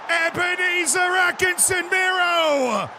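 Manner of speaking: excited commentator building to a roaring goal call; high, shouted goal commentary; live play-by-play. Pretend. Mock goal commentary on the match.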